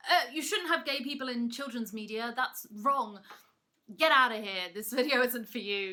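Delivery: mock homophobe shooing you away; mock bigot. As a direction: sneer it like a prude. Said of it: "people ... are like".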